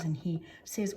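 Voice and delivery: usual speaking voice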